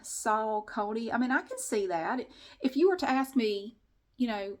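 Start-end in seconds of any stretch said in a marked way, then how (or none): 0.0-1.1 Saucony read slowly as sal-CONE-ee; as it looks. Shown as a demonstration. Vlogger reading it as it looks, slow sal-CONE-ee. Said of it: "that's kinda what it looks like".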